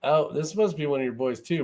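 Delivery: normal talking voice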